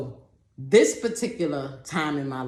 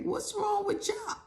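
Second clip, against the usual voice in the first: puzzled squeak; 6 dB quieter than usual. Retold or imitated. Puzzled quote, very high.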